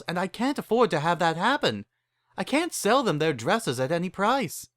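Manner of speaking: Rarity, fretful but polite; lightly voiced Rarity. Rarity pleads with the ponies, lightly voiced.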